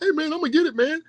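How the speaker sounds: high, silly voice of a fan hyping the doll; mocking the fans. Mocking fans who want the Barbie, high goofy voice. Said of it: "these dudes out here talking about".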